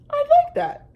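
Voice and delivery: high, loud squeaky dinosaur voice. A narrator's reading. Dinosaur accepting Lulu's cake invitation, high and loud. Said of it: "the dinosaur said".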